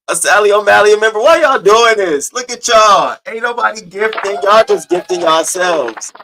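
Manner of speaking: high, loud mock outrage; playful loud scolding. Real, not acted. Host scolds viewers for gifting each other, loud mock outrage.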